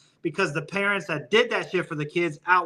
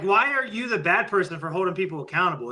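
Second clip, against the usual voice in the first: normal talking voice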